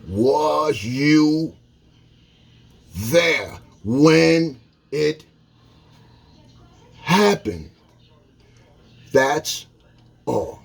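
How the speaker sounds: very slow, each word spaced; slow calm question. Shown as a demonstration. Showing he only asks calmly, very slow. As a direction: ask it slowly, no threat. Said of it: "My hands are not balled up".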